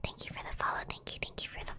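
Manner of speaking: hushed, very soft whisper; whispered thanks. Real, not acted. Streamer thanking a new follower, whispered.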